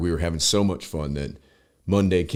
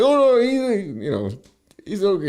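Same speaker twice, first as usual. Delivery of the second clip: imitating Sergey. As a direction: vouch for your friend in a pitched-up Russian accent. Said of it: "Sergey comes, he's like".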